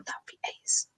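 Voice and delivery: soft unvoiced whisper; "ace" whispered under the breath; whispered aside. Shown as a demonstration. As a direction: breathe it out as a secret. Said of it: "make a note of British terms".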